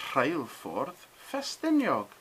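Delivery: "Rheilffordd Ffestiniog" slowly, ff as f and dd as th; slow Welsh demo. Shown as a demonstration. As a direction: carefully sound out "Rheilffordd Ffestiniog". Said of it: "Double F being an F sound".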